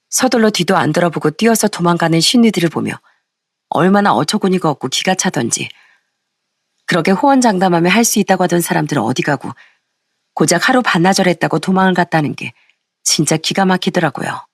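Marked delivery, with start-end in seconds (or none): none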